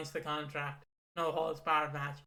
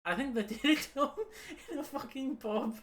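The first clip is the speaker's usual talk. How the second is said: higher voice, giggling between words; laughing through it; 7 semitones higher than usual. Real, not acted. Laughing about Vince's pub heart attack request, real giggles. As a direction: tell it while cracking up.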